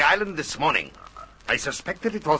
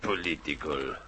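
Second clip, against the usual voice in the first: low, contemptuous scoff; scornful sneer; 9 semitones lower, 7 dB quieter than usual. Acted. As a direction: spit the word out in disdain. Nazi scoffs at Morgan's politics.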